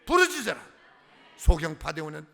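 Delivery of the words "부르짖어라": much louder and higher, a shouted decree; thundering command. Acted. Preacher quoting scripture's command.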